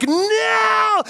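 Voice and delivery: very high, drawn-out mock wail; terrified seal. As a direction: cry out as the doomed seal. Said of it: "this expression on the seal's face".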